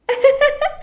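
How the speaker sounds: put-on squeaky girlish giggle; high giggle. Bride giggling at her husband, high and girlish.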